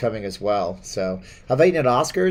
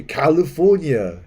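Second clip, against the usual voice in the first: California stretched slowly in a louder Austrian Arnold voice; Arnold accent; 4 semitones higher, 5 dB louder, slower than usual. Shown as a demonstration. Demoing Arnold for chat, slow Austrian California. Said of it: "You talk from this part of your voice".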